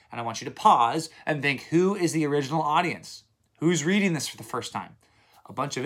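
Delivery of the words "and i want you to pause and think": ordinary talk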